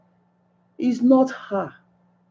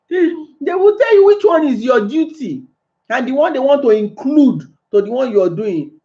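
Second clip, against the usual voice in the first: normal talking voice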